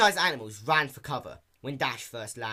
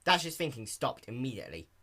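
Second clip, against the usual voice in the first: usual speaking voice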